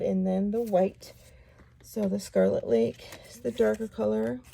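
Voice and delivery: ordinary delivery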